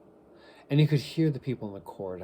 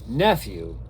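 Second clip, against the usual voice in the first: loud, stern address with falling end; 9 dB louder than usual. A narrator's reading. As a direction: cut in sternly and forcefully. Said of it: "returned the uncle sternly".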